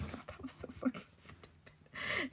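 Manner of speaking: hushed, laughing-at-herself whisper; embarrassed whisper. Real, not acted. After her silly Scooby reading, an embarrassed whisper. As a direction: whisper it, cringing at yourself.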